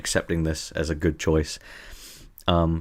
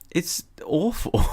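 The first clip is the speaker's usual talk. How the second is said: slow, higher, whimpering fake sob; mock crying; 10 semitones higher, slower than usual. Streamer fake-cries at the number generator, mock sobbing. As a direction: pretend to weep over the awful number. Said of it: "no, don't cry, don't cry".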